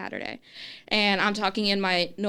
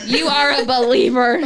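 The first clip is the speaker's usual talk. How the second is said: "You are" punched louder, word by word; louder, playful insistence on "are"; emphatic comeback; 2 semitones higher, 6 dB louder than usual. Retold or imitated. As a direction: hit ARE hard, half laughing.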